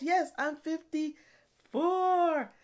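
ordinary talk

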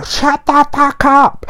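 very high, slow, drawn-out mock yell; fed-up yell at neighbour. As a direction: shriek it up at the ceiling in comic rage. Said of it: "I just wanna get a broom and go".

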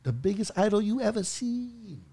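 mock Chinese accent. Shown as a demonstration. Comic performing his Chinese idol joke, mock Chinese accent. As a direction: stretch it out in a broad put-on Chinese accent. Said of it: "I do the Chinese idol joke".

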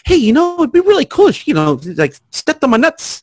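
bright high cheerful request; chirpy high voice. Shown as a demonstration. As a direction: ask it sweetly in a high voice. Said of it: "a different inflection on it".

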